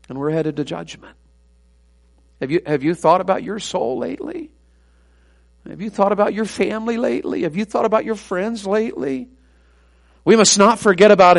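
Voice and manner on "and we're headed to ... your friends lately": hushed, searching questions; soft appeal. Real, not acted. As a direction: let each question sink in after a pause. Gentle appeal to congregation.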